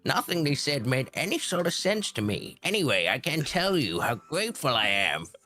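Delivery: high, excitable mad professor; crazy scientist voice. Acted. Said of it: "crazy scientist".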